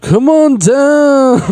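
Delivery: slow high hype call, half-hearted; radio jock voice. Shown as a demonstration. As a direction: stretch it like a showman. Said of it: "Do it again".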